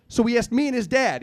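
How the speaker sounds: ordinary talk, nothing marked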